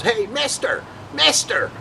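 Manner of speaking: high-pitched hailing voice; imitated stranger calling out. Stranger hailing a passer-by in the rain, calling out.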